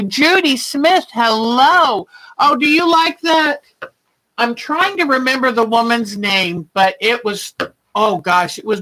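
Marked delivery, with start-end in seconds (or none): 0.0-3.6 cheery greeting. Real, not acted. Greeting a viewer by name.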